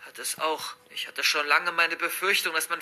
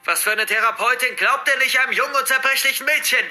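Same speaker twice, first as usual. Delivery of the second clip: raised, accusing voice with grief underneath; angry, despairing father; 5 semitones higher, 4 dB louder than usual. Acted. Father confronting the therapist he blames, angry and despairing. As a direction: let fury and despair build as the pitch climbs. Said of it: "die Traurigkeit und die Wut und die Verzweiflung in seiner Stimme".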